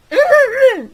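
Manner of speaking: very high, very loud hammed-up throat clear. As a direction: make a big show of clearing your throat. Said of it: "clearing her throat, loudly".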